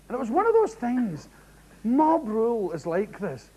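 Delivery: ordinary delivery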